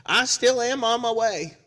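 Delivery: slow, high, loud imitation; insistent repeat caller. Caller phones hours later, loud and emphatic. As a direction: belt it out as a hammed-up caller. Third call, voiced for comedy.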